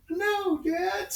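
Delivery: put-on very high-pitched kid's plea; hammed-up panicked cry to a parent; mock pleading. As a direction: squeal it like a boy about to be spanked. Imitates brother's scream from bathroom.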